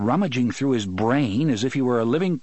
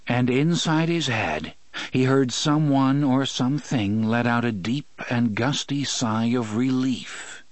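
usual speaking voice

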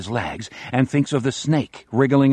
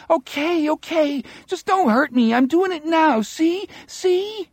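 much higher, whining terrified plea; high whiny voice. A narrator's reading. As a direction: whimper it out, scared out of your mind. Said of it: "He speaks in a high, whiny voice".

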